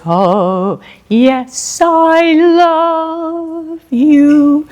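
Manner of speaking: "I love you" drawn out and rising high, with "you" left light and unstressed; weak ending syllable. Shown as a demonstration. Teacher demonstrates a strong and weak syllable to students, slow and lyrical. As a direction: stretch "love" slowly upward and let "you" fall away weakly. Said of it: "You could put words".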